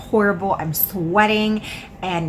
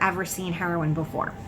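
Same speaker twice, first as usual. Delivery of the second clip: ordinary delivery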